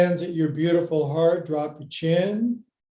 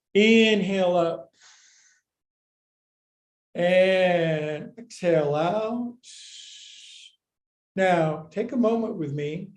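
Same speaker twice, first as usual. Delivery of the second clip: ordinary delivery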